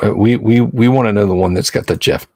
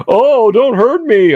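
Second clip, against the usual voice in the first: mock-frightened cry, pitched way up; scared T-Rex; 11 semitones higher than usual. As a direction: play the T-Rex begging not to be hurt. T-Rex voiced as timid.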